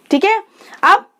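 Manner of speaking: ordinary delivery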